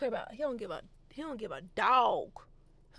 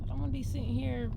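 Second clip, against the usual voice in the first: normal talking voice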